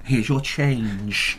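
slow, soft robotic checkout voice; mock machine announcement, slow and soft. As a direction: drone it out like a self-service till. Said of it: "Do that".

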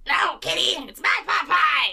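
high shouting bratty cartoon kid; whine-yell it like a spoiled cartoon boy; Cartman voice. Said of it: "that's classic Cartman".

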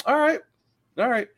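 mock resigned "all right", high; sarcastic high-pitched acceptance; fake agreement. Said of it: "It's like".

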